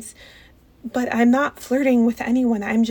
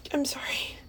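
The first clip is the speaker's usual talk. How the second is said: much softer, breathy whisper; whispered sorry; 9 dB quieter than usual. Acted. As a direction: drop to a whisper. Apologising quietly in public.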